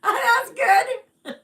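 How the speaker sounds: high, loud squeal through laughter; real delighted laugh-shout. Real, not acted. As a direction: burst out laughing with joy. Delighted reaction to a viewer.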